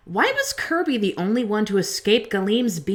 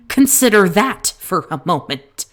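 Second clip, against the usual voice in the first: louder, forceful insistence; emphatic; 2 semitones lower, 6 dB louder than usual. Acted. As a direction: drive the point home loudly. Point repeated more forcefully.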